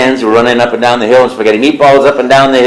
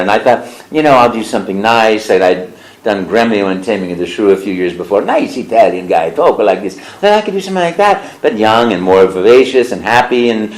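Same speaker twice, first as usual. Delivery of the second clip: ordinary talk, nothing marked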